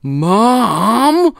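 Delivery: high, loud screeched Mom. Acted. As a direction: shriek it in protest. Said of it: "Amanda screeched".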